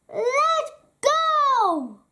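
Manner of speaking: high, loud frightened goat cry; panicked high yelp; terrified cry. Acted. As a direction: shriek to get free. Goat grabbed by troll.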